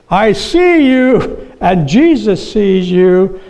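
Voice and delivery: high, slow squawking parrot voice. Acted. Parrot calling out to the burglar in the dark, high slow squawk. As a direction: drawl it out like a talking parrot, high and eerie.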